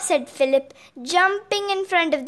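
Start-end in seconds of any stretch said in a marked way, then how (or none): none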